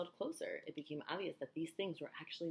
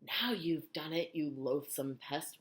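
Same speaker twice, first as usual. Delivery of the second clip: ordinary delivery, nothing marked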